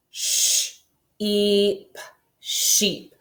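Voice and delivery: "Sh, e, p" split into separate sounds, then "sheep" blended; sounding out. Shown as a demonstration. Teacher sounding out sheep for the class.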